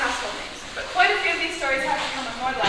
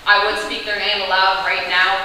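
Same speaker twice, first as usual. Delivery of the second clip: normal talking voice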